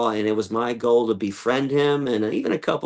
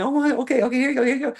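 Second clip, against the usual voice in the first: high, hurried placating; calm the huge bull while handing over the apple, pitched up; flustered; 11 semitones higher, much faster than usual. Retold or imitated. Handing Big Red an apple as he pushes him against the fence, flustered and high.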